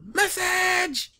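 very high-pitched comic yell; Keenen Ivory Wayans yell. Retold or imitated. Popping up at any social message, imitated yell. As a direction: holler one drawn word like an interruption. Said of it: "and he'd yell".

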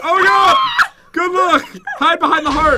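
high, loud frantic yelling; panicked shout. Real, not acted. Player warning partner to take cover, panicked shout.

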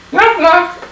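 high, whiny puppet voice; stubborn little dummy refusing; squeaky ventriloquist dummy. Acted. Dummy knock-knock joke.

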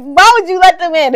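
loud, high incredulous question; mock indignant. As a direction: demand it loudly in comic disbelief. Acting reaction to opened door.

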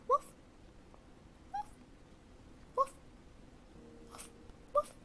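quiet little woof sounds, barked softly; gentle whispered dog barks; soft barking. Pretend. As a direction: bark like a small puppy, but hushed. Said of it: "I try to do it really quiet so it's not high pitch".

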